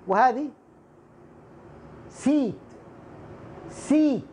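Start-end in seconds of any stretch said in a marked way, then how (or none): 2.1-4.2 سيت as "seat", long ee; long ya vowel. Shown as a demonstration. Teacher models seat for students, long ee in سيت. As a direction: hold the "ee" in سيت. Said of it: "سين ياء تاء".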